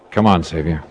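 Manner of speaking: ordinary delivery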